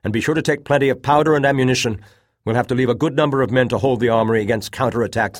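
loud, fast, high commands; urgent order. A narrator's reading. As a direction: rush the orders out. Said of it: "he ordered".

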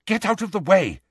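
high, brisk order; sharp command. A narrator's reading. Hermione moves the boys from the door, sharply. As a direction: order them aside sharply. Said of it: "said Hermione sharply".

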